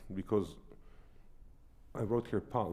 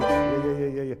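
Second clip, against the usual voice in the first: loud, higher yeah yeah yeah; fortissimo yeah; 6 semitones higher, 10 dB louder than usual. Shown as a demonstration. Teacher shouts along to show fortissimo energy. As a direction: belt it fortissimo. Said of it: "if this was fortissimo".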